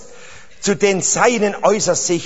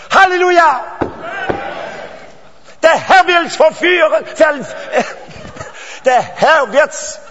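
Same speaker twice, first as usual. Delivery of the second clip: full-force preaching; 6 semitones higher than usual. Real, not acted. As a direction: shout the promise to the hall like a revival preacher. Preacher proclaiming to congregation.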